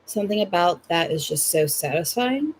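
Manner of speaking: usual speaking voice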